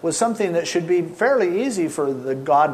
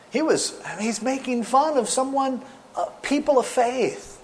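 high-pitched protest of the easily offended; acting out a scandalised objector; mock disapproval; 9 semitones higher than usual. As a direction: object on a raised, sustained pitch. Voicing modern objectors to Elijah.

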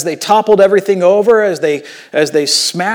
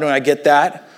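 ordinary talk, nothing marked

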